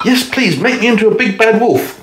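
very high, pleading piggy voice; eager little pig. A narrator's reading. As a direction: beg the fairy, high and eager. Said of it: "said the pig".

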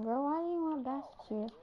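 ordinary delivery

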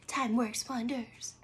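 soft, breathless whisper; hushed whispered echo of the quote. Shown as a demonstration. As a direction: whisper it back like a secret.

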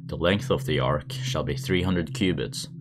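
plain reading voice

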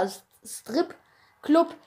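Strip-Club stretched out very slowly; second careful try at Strip-Club, slow; slow careful retry. Real, not acted. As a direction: draw out S-, Strip-Club carefully to get it right. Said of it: "oder wie man's auch immer".

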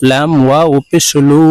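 ordinary talk

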